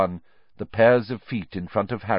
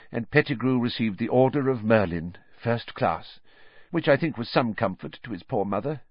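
higher official's voice recalling horror; pompous minister, shaken; 7 semitones higher than usual. Acted. Minister recalls the massacre to the landlady, shaken official voice. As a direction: play the minister haunted by the scene. Fudge character voice.

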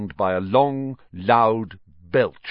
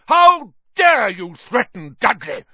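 outraged high-pitched roar; loud, indignant bellow; 12 semitones higher, 8 dB louder than usual. A narrator's reading. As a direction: roar it in fury. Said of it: "roared Uncle Vernon".

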